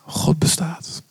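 hushed, breathless reveal; awed whisper. Retold or imitated. Preacher repeating the singer's conclusion in a whisper. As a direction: whisper it in awe.

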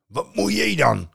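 loud high troll snap. Acted. Troll to Loki at the boat, loud. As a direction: challenge like a troll.

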